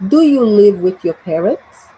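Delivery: "parents" swept upward at the end; rising intonation. Shown as a demonstration. Teacher saying it for students to repeat, rising on "parents". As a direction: raise the tone on "parents" like a yes-no question. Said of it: "have a rising intonation at the end".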